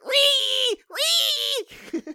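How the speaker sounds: high, loud piggy squeal; pig shriek. Shown as a demonstration. Friend demonstrating a pig impression, squealing pig shriek. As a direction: shriek like a pig being chased. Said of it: "I was gonna do a pig impression".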